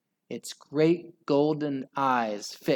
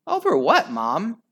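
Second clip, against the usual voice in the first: higher, curious little mouse voice; small child voice; 8 semitones higher, 6 dB louder than usual. A narrator's reading. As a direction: ask like a puzzled little child.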